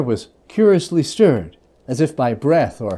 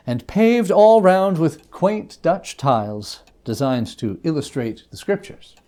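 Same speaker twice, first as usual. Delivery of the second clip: plain reading voice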